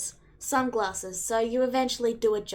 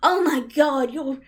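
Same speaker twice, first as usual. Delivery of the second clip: overwhelmed exclaiming; 4 semitones higher, 6 dB louder than usual. Acted. Blinded by magic, exclaiming.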